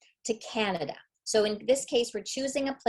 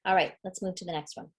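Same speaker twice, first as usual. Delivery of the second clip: ordinary talk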